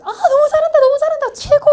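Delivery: high, loud cheering praise; excited praise. Acted. Teacher cheering his assistant after a smooth start. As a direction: cheer her on at full volume.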